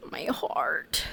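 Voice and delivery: breathless whispered gasp. Real, not acted. Shaken actor whispering to herself after a crying scene.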